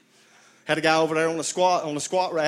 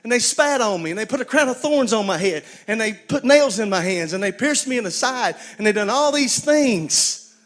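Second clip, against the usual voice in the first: voicing Jesus; 6 semitones higher, 5 dB louder than usual. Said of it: "And Jesus says".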